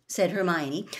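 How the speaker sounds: usual speaking voice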